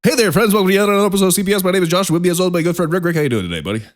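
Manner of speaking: high, loud, rapid-fire intro dropping at the end; auctioneer-quick podcast greeting; as fast as possible. Real, not acted. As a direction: rattle off the memorized opening at top speed. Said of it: "that's as fast as I can do the intro".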